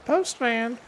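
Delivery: high, loud squawked "Postman!"; a shrill-free high parrot call, loud and sharp; parrot impression. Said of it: "That's my best parrot impression there".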